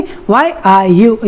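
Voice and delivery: very slow, each word split apart; halting, broken-up phrasing; word-by-word crawl. Shown as a demonstration. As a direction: drag out every single word as a bad example. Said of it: "ऐसे नहीं".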